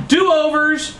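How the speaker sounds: very high-pitched cry; hammed-up high plea; desperate repeat. A narrator's reading. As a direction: shout the call high, desperately. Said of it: "repeat button in desperate ploy".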